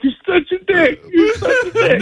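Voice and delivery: high-pitched weepy imitation through laughter; squeaky mock crying; mock tearful. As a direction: imitate a blubbering friend, half laughing. Imitates tearful friend while hugging.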